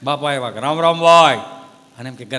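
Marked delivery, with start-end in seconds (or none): 0.6-1.4 put-on louder, drawn-out old man's hail; loud greeting. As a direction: call out to the shop. Old man greeting shopkeeper.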